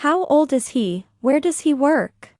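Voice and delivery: usual speaking voice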